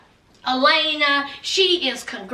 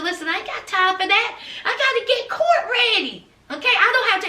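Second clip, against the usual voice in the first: high, quick mock protest; impatient refusal; 7 semitones higher, faster than usual. Bill refusing the family photo before court, impatient. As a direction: brush it off in a raised, hurried voice.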